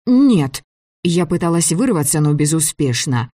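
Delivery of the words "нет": loud, high-pitched refusal; protesting. Acted. Girl being dragged off by director, protesting loudly. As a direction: refuse sharply, pitch jumping up.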